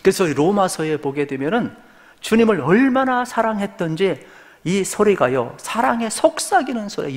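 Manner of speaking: high-pitched, impassioned sermon delivery; fervent preaching. Real, not acted. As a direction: preach it high and urgently to the congregation.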